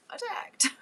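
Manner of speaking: Attacked in a high, soft, amused voice; high soft reading. Shown as a demonstration. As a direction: toss off Attacked as a high, quiet joke. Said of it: "all I read was attacked".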